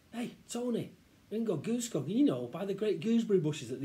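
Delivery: put-on high, eager kid voice; tempting boy. Child tempts Tony to go gooseberry picking, eager. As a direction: entice a friend with a plan.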